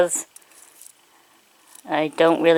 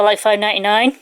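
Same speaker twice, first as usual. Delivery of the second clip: ordinary talk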